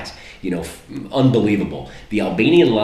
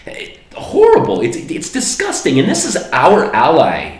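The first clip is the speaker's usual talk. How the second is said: outraged outburst; 9 semitones higher, 5 dB louder than usual. Real, not acted. Vlogger fuming that nobody reports the destroyed churches, outraged high outburst. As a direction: voice jumps way up and louder in disgust.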